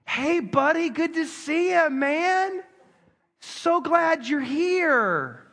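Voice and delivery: high, slow, put-on friendly greeting; a touch of hammed-up niceness; fake preacher welcome. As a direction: greet the kid with forced pastoral cheer. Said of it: "what every good preacher would do".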